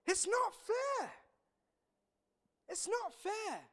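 put-on high, slow childish whine; grumbling worker whining about fairness; whiny complaint. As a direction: sulk like a wronged child.

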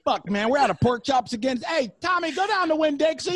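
a harried manager yelling to a worker; meat manager. Retold or imitated. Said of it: "the meat manager at Produce is like".

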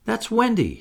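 very high, wondering recognition; name the voice in a high pitch; high exclamation. A narrator's reading. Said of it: "she said".

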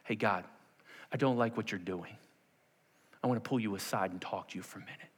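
low, subdued word taken aside with God; confiding complaint. Acted. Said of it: "we're all a little bit like Peter".

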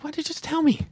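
high-pitched mock exasperation; exasperated. Son retelling his reaction to dad's news, exasperated. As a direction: pitch it way up, incredulous. Acts exasperated reply to dad.

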